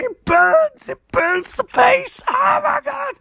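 high, strained scream of pain, slowing on the face. Retold or imitated. Barry burned by gooey liquid, screaming high in pain. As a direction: wail that it burns like a cartoon victim. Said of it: "And he's like".

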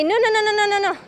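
high, rapid string of no's; a receptionist high-pitched and insistent, refusing her entry; insistent refusal. Retold or imitated. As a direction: shoo her away with repeated no's.

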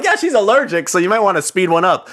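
sweetly pressure the cook to hurry; mock sweet nudge. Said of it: "And I'm like".

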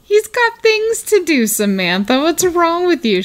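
high mocking retort, a pitch up; hammed-up scolding of Samantha; mock Richard.

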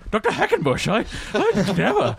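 hammed-up mid-Atlantic film lady; mid-Atlantic accent. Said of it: "What is that accent?".

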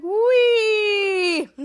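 high, loud playful squeal; Jasper's wee. Retold or imitated. Jasper sliding down the tube, squealing. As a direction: squeal like a kid on a slide. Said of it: "Jasper's like".

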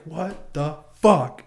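slow, loud, high, drawn-out mock exasperation; officer's outburst. Officer stopping everything over the delay, slow loud outburst. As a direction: drag it out like a fed-up officer.

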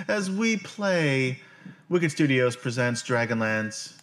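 loud, high announcer welcome; host welcome back. Acted. GM welcoming the audience back, loud and high. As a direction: hype up the viewers as the show host. Streamer intro after break.

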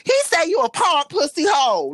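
imitating amused woman. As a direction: imitate her jumping up in pitch, amused. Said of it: "she laughing, and she's like".

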